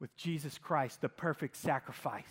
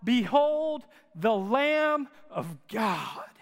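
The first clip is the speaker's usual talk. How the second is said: slow, loud, higher proclamation; yelling out; 6 semitones higher, 7 dB louder, much slower than usual. Retold or imitated. John the Baptist crying out as Jesus approaches, a slow loud proclamation. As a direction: call it out across the river, slowly. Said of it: "he yells out".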